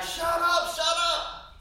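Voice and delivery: half-joking irritated yell; fed-up shut up. Retold or imitated. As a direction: snap it out, annoyed at the phone guy. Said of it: "And you're like".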